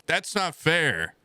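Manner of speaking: slow, high-pitched grievance; aggrieved complaint. Real, not acted. Complains about catching mono, first take.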